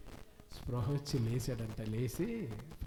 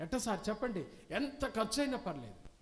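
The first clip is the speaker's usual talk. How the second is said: eager begging; 6 semitones higher than usual. Acted. Family begging the professor.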